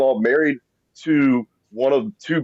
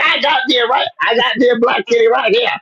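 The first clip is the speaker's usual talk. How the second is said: role; 9 semitones higher than usual. Retold or imitated. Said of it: "I'm like".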